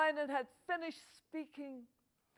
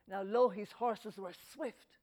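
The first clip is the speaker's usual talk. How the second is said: plain reading voice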